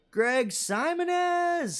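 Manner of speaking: high, long-drawn name call; calling out greeting. Real, not acted. Streamer greeting a viewer by name, calling out. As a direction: sing out the name, stretched and high.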